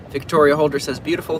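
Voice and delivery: normal talking voice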